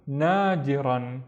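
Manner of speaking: "nadiran" drawn out slowly; slow, careful "nadiran"; slow blend. Shown as a demonstration. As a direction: stretch "nadiran" out for learners. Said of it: "slowed word demo".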